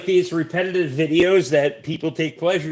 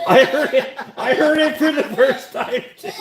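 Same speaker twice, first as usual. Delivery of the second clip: higher voice through laughter; laughing admission; 7 semitones higher, 3 dB louder than usual. Real, not acted. Joining a friend's laughing fit, high laughing admission. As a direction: squeeze the words out while laughing. Laughing along after the gross moment.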